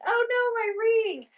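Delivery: high panicked cry; sudden alarm at the lost ring. Retold or imitated. Panicked retold realization.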